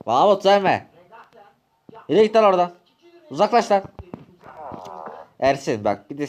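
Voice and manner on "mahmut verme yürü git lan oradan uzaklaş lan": higher, loud shouting him away; öfkeli kovma. Acted. Ilaç kavgasında bağırış. (Mahmut'a bağırarak kovma)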